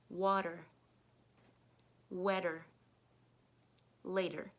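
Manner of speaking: teacher modeling the flap T; flap T demo. Shown as a demonstration. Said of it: "so the words".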